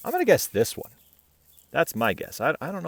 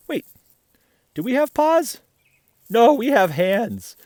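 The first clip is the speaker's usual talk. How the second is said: high, louder mock gasp of a question; playful surprise; 6 semitones higher, 6 dB louder than usual. Asking the children with a playful high surprise. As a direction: pretend to be startled for the kids.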